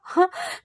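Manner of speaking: short high mocking laugh; acted scoff-laugh; scornful laugh. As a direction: let out a brief derisive laugh. Scornful laugh after sarcastic remark.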